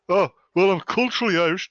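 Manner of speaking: loud mocking singsong. Mocking Irish-Americans.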